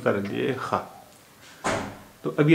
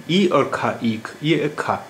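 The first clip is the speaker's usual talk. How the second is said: ordinary talk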